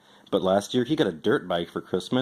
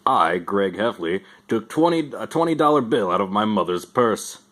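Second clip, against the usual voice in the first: Roderick's mock confession. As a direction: announce the crime like a ceremony. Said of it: "Roderick feeding Santa Scout bad information".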